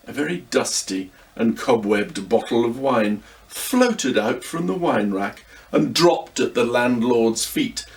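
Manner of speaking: usual speaking voice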